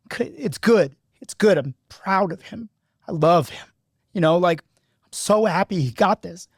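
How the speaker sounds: high, choked, proud and tearful; crying happy tears. Real, not acted. Coach telling girlfriend's son why he cries, tearful. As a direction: reassure through the tears. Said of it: "I'm crying on the podcast".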